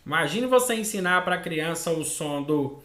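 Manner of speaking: ordinary delivery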